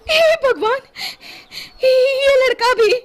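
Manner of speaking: very high, distressed cry; a mother missing her boy; panicked mother. A narrator's reading. Mother finds child missing. (घबराई माँ की ऊँची पुकार)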